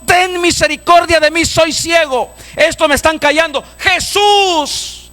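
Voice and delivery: high, urgent plea; preacher acting a desperate blind man; crying out. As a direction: beg at the top of your range. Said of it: "gritó y dijo".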